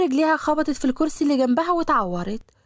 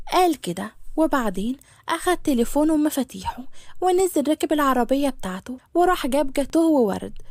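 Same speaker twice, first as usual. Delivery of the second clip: ordinary delivery, nothing marked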